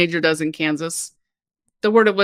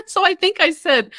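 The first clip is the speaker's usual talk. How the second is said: laughing; 10 semitones higher than usual. Real, not acted.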